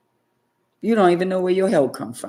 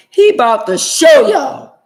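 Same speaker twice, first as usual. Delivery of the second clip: "He about to show y'all" shouted much louder and higher, slowly; preacher's shout; 9 semitones higher, 13 dB louder, slower than usual. Real, not acted. Repeated as a shouted warning.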